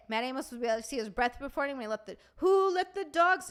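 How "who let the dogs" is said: high, drawn-out excited outburst; worked up, excited. Real, not acted. Streamer getting carried away about food, excited outburst. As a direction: burst out with it, raised pitch. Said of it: "I'm getting worked up. I'm getting excited".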